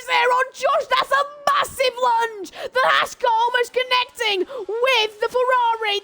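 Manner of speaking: high, rising play-by-play call; excited race commentary. Acted.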